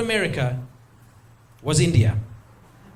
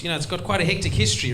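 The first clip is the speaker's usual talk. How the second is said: ordinary talk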